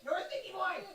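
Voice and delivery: playful high voice, away from the mic. Real, not acted. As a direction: tease a little one in a high sing-song. Teasing a child away from mic.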